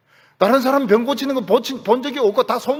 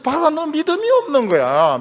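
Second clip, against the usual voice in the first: mock accusation; 7 semitones higher, 5 dB louder than usual. Mock accusing preacher quote.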